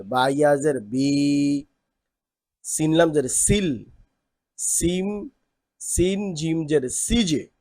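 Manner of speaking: slow, deliberate reading of each syllable; drill recitation. Shown as a demonstration. Teacher showing students how letters join, slow recitation. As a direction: spell it out slowly for the class.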